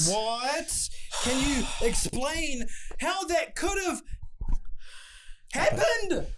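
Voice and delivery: stunned that he almost died, rising to a squeak; shocked disbelief. Real, not acted. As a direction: voice shooting up in alarm. Friend nearly hit by car.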